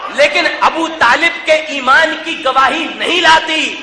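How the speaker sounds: impassioned sermon, building to a crowd-rousing finish; fiery majlis oratory; forceful preaching. Real, not acted. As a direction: preach it hard to a big crowd.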